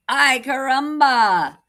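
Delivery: loud, slow exclamation; crying out. Acted. Chicken refusing the empty nest, loud exclamation. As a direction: chicken protests loudly.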